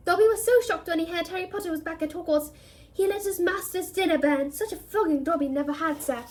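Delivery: high, pleading little elf; house elf voice. A narrator's reading. The elf reproaches Harry, high and pleading.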